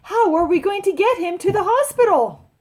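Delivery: high, loud storybook alarm; mother's yell. A narrator's reading. Mother wonders about getting to hospital, high alarmed yell. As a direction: call it out high and urgent. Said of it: "yelled Loretta's mother".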